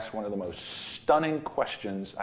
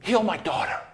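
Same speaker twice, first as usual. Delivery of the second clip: imagined plea; 6 semitones higher, 4 dB louder than usual. Retold or imitated. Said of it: "Maybe he would have said".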